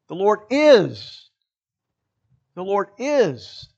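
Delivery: slow line landing heavily on "is"; stress on IS. Shown as a demonstration. Preacher modelling extra emphasis for congregation, stress on "is". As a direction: stretch out "is" with a falling tune. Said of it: "to emphasize the word is".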